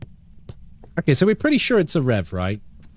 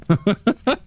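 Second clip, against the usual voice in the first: laugh; 5 semitones higher than usual. Real, not acted. Laughs after own joke.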